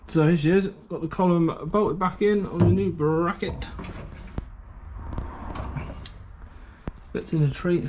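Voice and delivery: panting between words; breathless from the heat; out of breath. Real, not acted. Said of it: "Sorry for the panting".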